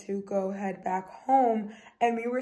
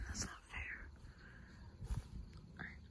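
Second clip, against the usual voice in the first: hushed whisper while searching; 17 dB quieter than usual. Real, not acted. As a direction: keep it low by the pond. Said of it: "I gotta be quiet".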